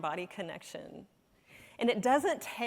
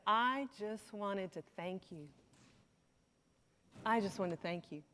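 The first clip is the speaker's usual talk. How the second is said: tender thanks; 2 semitones lower, 6 dB quieter than usual. Retold or imitated. Dying woman thanking her doctor at her bedside, tender and soft. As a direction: let the thanks sink in gently.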